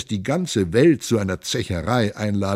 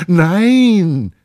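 a much higher, louder frightened no; crying out no; 10 semitones higher, 9 dB louder than usual. Acted. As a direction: cry out to stop him. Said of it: "rief sie".

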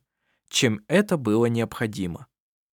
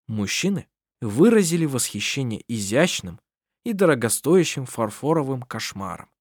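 ordinary delivery

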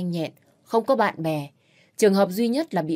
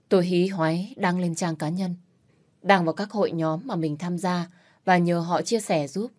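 ordinary delivery, nothing marked

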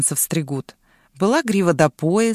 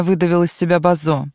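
ordinary delivery

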